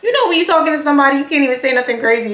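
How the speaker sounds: ordinary talk, nothing marked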